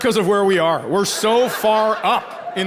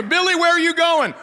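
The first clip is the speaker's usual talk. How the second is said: higher, mock mom voice; mother calling out; 8 semitones higher than usual. Mom calling to Billy as he leaves, higher voice. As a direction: call after your kid in a higher voice.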